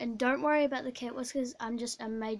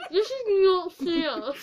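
slow, laughing through a full mouth; laughing protest; 6 semitones higher, 8 dB louder than usual. Real, not acted. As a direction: laugh out a mock complaint, cheeks stuffed.

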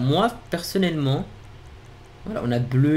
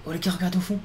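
ordinary talk

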